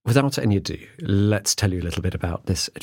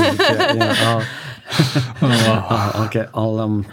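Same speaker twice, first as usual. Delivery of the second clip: laughing; 4 dB louder, slower than usual. Real, not acted. Host cracking up at the free bees pun.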